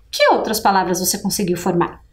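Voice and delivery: ordinary talk, nothing marked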